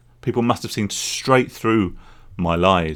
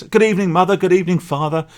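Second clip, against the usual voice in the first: higher-pitched, overly polite feigned sobriety; best sober voice; 9 semitones higher, faster than usual. Retold or imitated. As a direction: put on your best sober voice for the parents. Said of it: "putting on my best sober voice".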